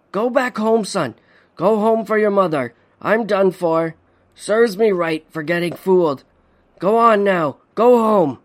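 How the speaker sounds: high, strained father urging his son away; pleading father. A narrator's reading. Trapped father sending his son home, strained plea. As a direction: beg him to leave, voice straining.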